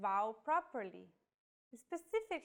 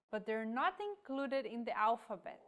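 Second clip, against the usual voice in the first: ordinary talk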